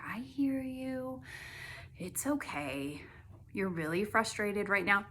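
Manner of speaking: slow it down to settle yourself; soothing self-talk. Retold or imitated. Calming her own frustration in the waiting room, soothing. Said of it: "talked myself down".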